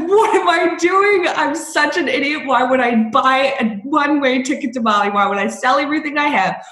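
high, fast panicky self-questioning; panicked inner voice. Retold or imitated. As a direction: spiral into panic, voice climbing. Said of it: "And I was so scared".

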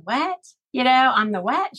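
high, rising disbelief; retold baffled question. Mother questions her daughter in a dream, baffled.

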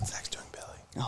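very soft, slow whisper; hushed running commentary; whispered aside. Real, not acted. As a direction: whisper so as not to interrupt the performer. Whispered aside during the recital.

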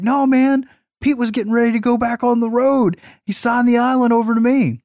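high, hurried stoner excuse; defensive stoner Dave. Retold or imitated. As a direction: insist it like a caught liar.

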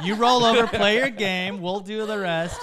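loud laughing tease; laughing loudly. Real, not acted. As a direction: laugh through a cheeky offer. Laughing, excited teasing offer.

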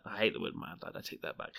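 whispered; whispered aside. Real, not acted. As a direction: take it back under breath. Self-correction aside.

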